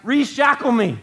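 high, loud mock cry; mock eager plea. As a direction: call it out as an absurd request. Acting out begging for chains back.

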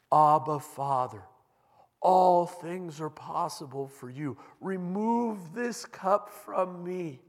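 loud, high, slow pleading prayer; Jesus begs his Father loudly in anguish. Acted. Jesus prays in Gethsemane.

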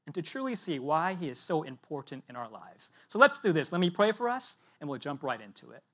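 ordinary talk, nothing marked

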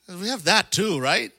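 ordinary talk

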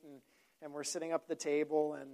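usual speaking voice

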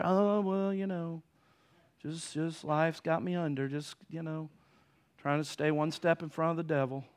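soft, mumbling tired grumble; gloomy complainer. Retold or imitated. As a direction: sound worn down and defeated. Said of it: "instead of saying".

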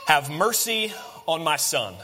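drawn-out appeal for mercy; slow, weighted quote of a plea; slow solemn plea. Acted. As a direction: plead slowly, lingering on the words. Father's plea quoted, slow take.

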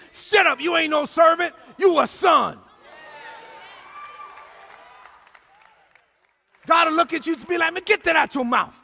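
loud, shouting sermon delivery, big swells on key lines; preaching at full force. Acted. Pastor exhorting the congregation and online viewers, shouting preacher. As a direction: preach it like a revival pastor calling his church home.

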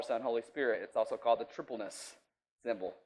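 ordinary talk

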